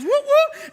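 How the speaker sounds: high, loud whoop whoop like a siren; police siren imitation. Officer arriving to make the arrest, loud siren whoops. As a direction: mimic a police car siren. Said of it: "the police officer goes".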